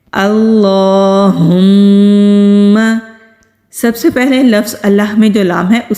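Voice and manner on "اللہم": اللہم recited very slowly, heavy lam, ha from the throat, long nasal meem; slow tajweed recitation. Shown as a demonstration. Teacher recites اللہم slowly for students learning durood. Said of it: "صحیح تلفظ کے ساتھ پڑھنا سیکھتے ہیں".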